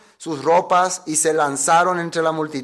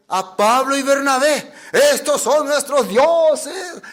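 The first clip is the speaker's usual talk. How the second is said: high, slow, laughing worship of new gods; awed priest; 8 semitones higher, slower than usual. Retold or imitated. Priest greets Paul and Barnabas as gods, high and slow. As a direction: hail the apostles as gods with a laugh. Priest offering sacrifice to apostles.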